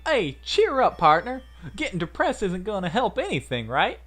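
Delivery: high, loud Southern pep talk; Southern girl. Acted. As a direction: cheer him up in a perky Southern accent. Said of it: "why I made her Southern".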